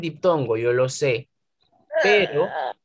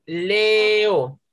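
"Leo" held long on the E, then O; teacher splitting "Leo" into two syllables; separated vowels; 3 semitones higher than usual. Shown as a demonstration. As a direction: draw out "Leo" as le-o. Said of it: "Hay dos vocales abiertas".

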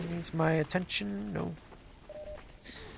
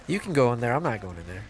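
usual speaking voice

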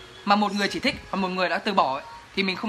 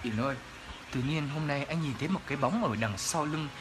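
much lower, much softer spooky murmur; ghost story voice; 7 semitones lower, 11 dB quieter than usual. Retold or imitated. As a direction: creep out the cousin, low and hushed. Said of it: "mình nói giọng kiểu này à".

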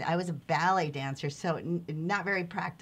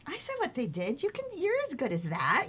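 higher-pitched voicing of a mother; mom's retort; 8 semitones higher than usual. Mom answering her hysterical daughter after acting class, higher-pitched retort. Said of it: "And she's like".

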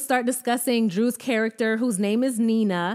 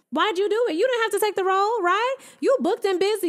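sarcastic mock question; 8 semitones higher, faster than usual. Real, not acted. Sarcastically questioning Drew directly.

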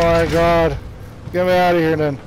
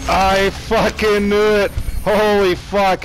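frustrated outburst; 5 semitones higher, 3 dB louder, slower than usual. Real, not acted. Enemy UAV spotted, outburst.

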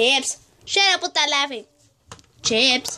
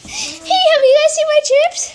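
loud and fast; 11 semitones higher, 5 dB louder than usual. Pretend. Character asks loudly.

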